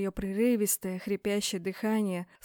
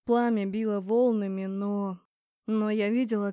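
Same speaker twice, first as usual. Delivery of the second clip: ordinary delivery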